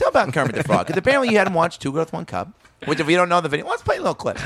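high-pitched talk-show host voice; Jay Leno impression. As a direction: imitate a late-night host stumbling into his question. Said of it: "Leno's gonna be like".

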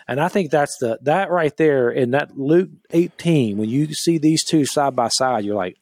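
ordinary talk, nothing marked

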